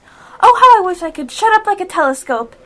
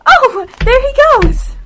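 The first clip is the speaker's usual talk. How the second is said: higher, louder, drawn-out delight; excited spotting; 7 semitones higher, 5 dB louder, slower than usual. Acted. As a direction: point and cry out as he runs. Alice spots rabbit.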